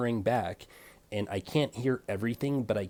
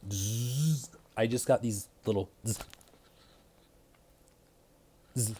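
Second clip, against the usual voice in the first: clipper buzz. Retold or imitated. Imitating the small clipper touches.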